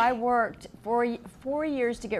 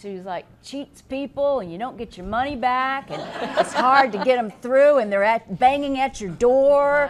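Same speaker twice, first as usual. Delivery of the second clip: louder, laughing indignant rant; 2 semitones higher, 8 dB louder than usual. Real, not acted. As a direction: vent about the cable companies, half laughing. Ranting about cable companies.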